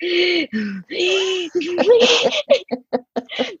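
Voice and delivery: exaggerated high gasps breaking into laughter; comic, drawn-out gasping; mock gasping for air. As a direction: act out being squashed and straining for breath. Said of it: "gasping up for air".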